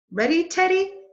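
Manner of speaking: high, loud, eager call; girl rousing the puppy, eager; eager question. Acted. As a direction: ask it bright with excitement. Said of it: "asked Annie".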